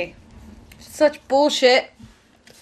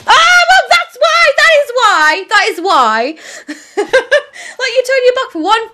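high, loud indignant yell; exasperated laughing shout; real outraged shout; 12 semitones higher, 7 dB louder than usual. Real, not acted. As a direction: shout it out at the screen. Killed from behind in game.

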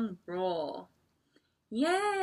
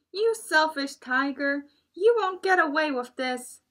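loud, high little-animal scolding; small animal standing up to the tiger; 7 semitones higher, 7 dB louder than usual. Acted. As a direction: shout it up at the bully.

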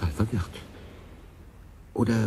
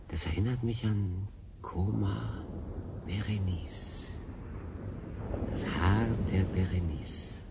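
tender hush; 4 semitones lower, 10 dB quieter, slower than usual. Acted. Naming the crystal tenderly.

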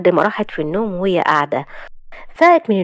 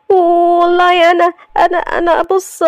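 high stammering wife, slow and halting; flustered young wife; 11 semitones higher, 4 dB louder, slower than usual. Acted. Young wife caught by her husband, stammering high. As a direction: caught out, she stumbles over her words.

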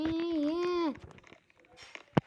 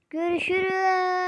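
high, loud hammed-up wail; mock crying goodbye; 5 semitones higher, 6 dB louder than usual. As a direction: wail a dramatic farewell. Farewell before jumping to death.

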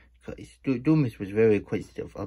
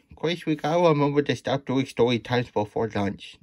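ordinary delivery, nothing marked

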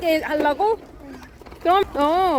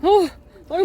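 ordinary talk

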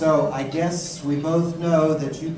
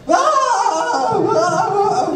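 short high shrieks, much louder; three sharp yelps, much higher and louder; shrieking; 11 dB louder, much slower than usual. Acted. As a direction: shriek like shaking off bugs. Wife shaking off project management.

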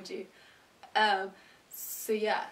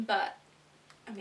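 usual speaking voice

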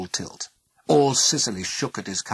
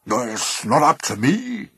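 slow, loud, gruff refusal; 4 semitones higher, 6 dB louder, slower than usual. A narrator's reading. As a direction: grumble it out slowly with authority. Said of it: "he said gruffly".

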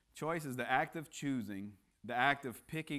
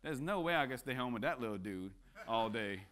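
ordinary talk, nothing marked

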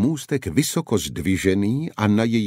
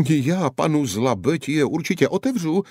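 higher eager outcry; exclaiming; 8 semitones higher than usual. A narrator's reading. The guard gives in to the knights, relieved exclaim. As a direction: blurt out the promise in relief. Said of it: "zvolal Maligu".

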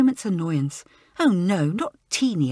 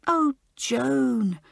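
gasp; 5 semitones higher than usual. A narrator's reading. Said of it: "gasped Elizabeth".

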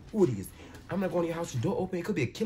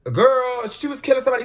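higher and louder, insistent; heated protest; 8 semitones higher, 7 dB louder than usual. Real, not acted. Answering the show, loud and insistent. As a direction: argue it back hard. Defending the shooting.

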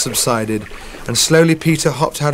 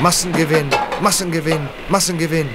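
pleading ship's voice, a little higher; 5 semitones higher than usual. Acted. The ship pleading with herself in the storm, mildly strained. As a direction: beg yourself to keep going. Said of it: "she pleaded".

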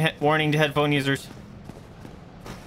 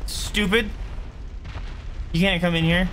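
taunting; 6 semitones higher than usual. Pretend. Taunting enemy through gate.

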